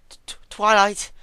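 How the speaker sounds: high, loud stuttering plea; urgent stammering plea. A narrator's reading. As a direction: stutter her name as you beg for mercy. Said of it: "she pleaded urgently".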